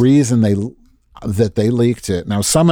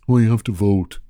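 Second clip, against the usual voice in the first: dismissive mock shrug; lightly voiced prim senator; mock Warren; 3 semitones lower than usual. Host parodying a senator's advice, dismissive mock tone. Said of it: "that's what she said on The View".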